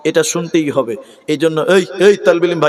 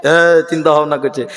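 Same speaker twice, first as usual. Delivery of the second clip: ordinary delivery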